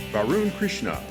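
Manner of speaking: ordinary delivery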